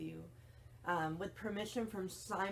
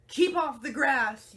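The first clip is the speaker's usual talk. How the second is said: very loud, higher angry shout; Hank yelling; 6 semitones higher, 10 dB louder than usual. Acted. Hank shouts at the striding cowboy, very loud and high. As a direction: yell the rule at the stranger. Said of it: "yelled Hank".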